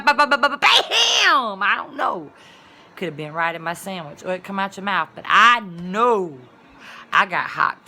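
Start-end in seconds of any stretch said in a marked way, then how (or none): 0.0-1.6 quick bas building up to a loud high bam; rapid ba-ba-bam. Pretend. Showing how fast it could happen, building to a loud bam. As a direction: rattle off the bas and explode on bam.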